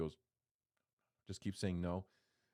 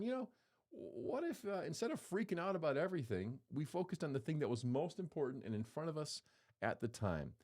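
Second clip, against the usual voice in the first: higher, lightly voiced Mary suggesting an idea; voicing Mary; 6 semitones higher than usual. Mary teaching a life hack, higher and lightly voiced. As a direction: float the idea up high like a gentle suggestion. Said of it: "She's going".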